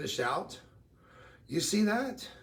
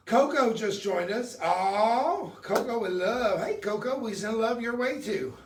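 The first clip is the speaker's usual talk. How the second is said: excited greeting; 6 semitones higher, 6 dB louder, slower than usual. Acted. Greeting viewers with big energy.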